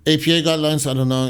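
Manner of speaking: usual speaking voice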